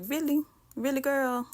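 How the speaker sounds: incredulous. Real, not acted. To her mom over separating the noodles, incredulous. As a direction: roll your eyes at her. Fed up with mom's request.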